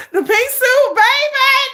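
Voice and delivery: high, loud swoon; squealing fan delight. Real, not acted. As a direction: gush it out, smitten and loud. Swooning over pink suit.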